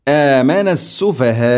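"ആമന സുഫഹാ" chanted slowly, final vowel held very long; elongated recitation. Shown as a demonstration. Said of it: "ഹർക്കത്തിൻ്റെ ഖദറിൽ നീട്ടണം". ("ആമന സുഫഹാ" നീട്ടി ഓതുക)

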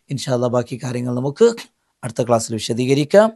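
ordinary talk, nothing marked

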